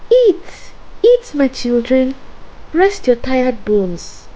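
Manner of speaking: put-on high-pitched old woman urging food; sweet-talking witch host; coaxing old woman. A narrator's reading. Old woman urges children to eat.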